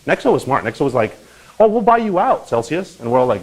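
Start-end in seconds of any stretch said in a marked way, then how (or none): 1.6-3.0 much higher, brighter mock-confident offer; breezy offer. Nexo offering to buy out Celsius, hammed-up cheerful. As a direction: toss it off like a too-good rescue.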